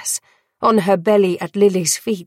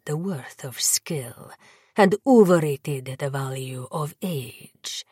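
low whispered vampire lord; 3 semitones lower, 4 dB quieter than usual. A narrator's reading.

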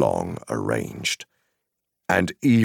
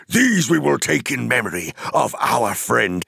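high, ringing declaration; raised, forceful voice of proud defiance; impassioned cry; 9 semitones higher, 5 dB louder than usual. Acted. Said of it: "he cried".

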